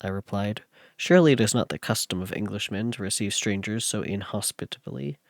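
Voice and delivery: steady, even read-aloud narration with the quoted lines voiced a little; story narration. Streamer reading Frankenstein to chat, story narration. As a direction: read it like a classic novel to listeners.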